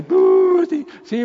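mock reaction. Parent mocks crying child.

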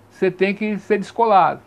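normal talking voice

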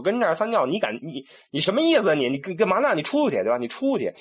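mock outraged attendant. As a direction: throw the intruder out, offended. European attendant outraged at intrusion. (欧洲服务员被冒犯，气愤地赶人出去)

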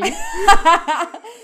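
loud, high laugh; high-pitched cackling outburst; burst of laughter. Real, not acted.

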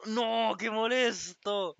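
loud, high, drawn-out groan of annoyance; dismayed exclamation. Real, not acted. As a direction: cry out in real dismay, stretching the words. Reacts to ant inside monitor.

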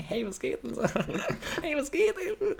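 very high, put-on chatty voice; high mock small talk with a giggle; chatting in class. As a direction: imitate pupils chattering in a squeaky pitch. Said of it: "diese, du redest so".

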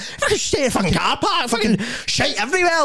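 high, mock-angry rant; hammed-up high complaining; annoyed ranting. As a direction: moan like he's fed up with everything. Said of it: "He was like".

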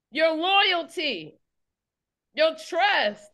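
fervent preaching at full force. Acted. Preacher rousing her congregation, fervent preaching. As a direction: drive the message home, rising and urgent.

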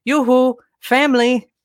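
high singsong mock Norwegian call; child mimicking Oaken. Daughter waking dad in his ear, high singsong mimicry. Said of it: "impersonating a bit character in a Disney movie".

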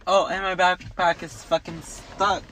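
ordinary talk

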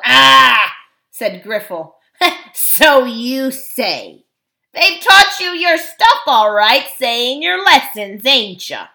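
loud, mocking dwarf with a laugh; scoffing dwarf. A narrator's reading. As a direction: sneer at the child's story.

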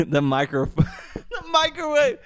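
laughing at own slip; sheepish laughing aside. Real, not acted. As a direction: giggle through the correction.

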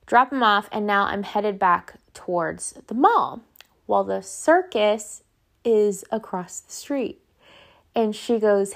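ordinary talk, nothing marked

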